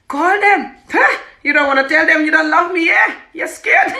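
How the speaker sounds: high, loud taunting demands; hammed-up angry goading; mock badgering husband. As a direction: shout it accusingly, close to laughing. Imitating husband badgering her.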